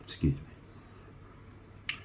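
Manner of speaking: soft slow whisper; a hushed slow excuse after coughing; whispered apology. Real, not acted. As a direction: breathe it out barely audible.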